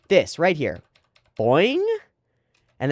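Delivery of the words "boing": high boing with a rising end; bouncy boing. Shown as a demonstration. Streamer showing the camera bounce, playful boing. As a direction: bounce the word upward, playfully.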